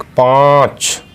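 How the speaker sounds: पाँच drawn out slowly through the nose; nasal vowel. Shown as a demonstration. Teacher showing children a nasal vowel, slowly. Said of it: "नाक में बोले जाने वाला".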